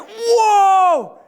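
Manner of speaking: high, loud startled cry; frightened whoa. Retold or imitated. Looking straight down off the ledge, a high frightened cry. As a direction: yelp as the drop hits you.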